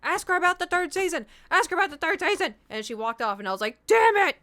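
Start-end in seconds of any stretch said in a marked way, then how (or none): 0.0-2.6 loud, high, urgent mock yell; imitated inner scream. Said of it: "in my head, I'm like". 3.9-4.4 very loud, high yell of regret; mock frustrated shout. Retold frustration as girl walked off.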